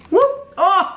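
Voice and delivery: high startled whoop; sudden shocked cry; surprised yelp. Real, not acted. Food spills out of bowl.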